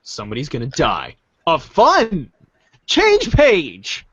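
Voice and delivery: ordinary talk, nothing marked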